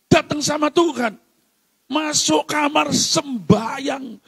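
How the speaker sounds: high, urgent exhortation; forceful preaching. Real, not acted. Preacher urging the congregation to turn to God, forceful preaching. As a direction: press it home from the pulpit.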